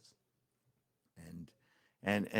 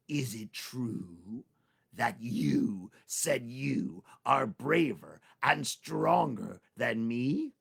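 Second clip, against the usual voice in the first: menacing tiger; 3 dB louder, much slower than usual. Acted. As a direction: question him like a patient predator. Tiger confronts Tricky.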